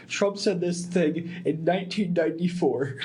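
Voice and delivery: mock political ad announcer; fake news ad voice. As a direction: put on a fake ad voice. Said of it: "and they're like".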